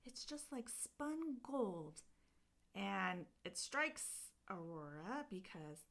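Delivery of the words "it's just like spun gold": admiring fairy. Retold or imitated. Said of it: "they're saying".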